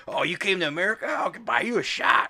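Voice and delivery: high-pitched mock tourist; gushing tourist. Tourist offering the foreigner a drink, hammed-up high voice.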